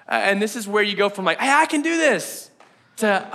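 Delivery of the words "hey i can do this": high, eager can-do burst; upbeat self-belief, acted out; confident excitement. Acts out early confident desire.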